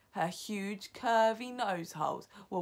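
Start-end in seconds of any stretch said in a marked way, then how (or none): none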